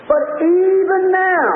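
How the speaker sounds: high, drawn-out preacher's cry; acted outcry of faith; fervent plea.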